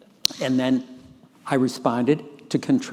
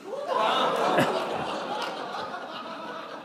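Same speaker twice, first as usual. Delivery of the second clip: a breathy, high-pitched laugh; 5 dB quieter than usual. Real, not acted. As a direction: laugh high and airy at the memory. Laughing at the malpractice line.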